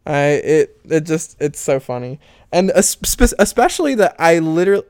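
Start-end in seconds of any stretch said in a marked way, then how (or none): 2.5-4.9 tripping over words in real excitement; excited stuttering. Real, not acted. Venting about comments on the relationship, excited stuttering. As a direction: rush in and stumble on the word starts, worked up. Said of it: "I'm stuttering 'cause I'm getting excited".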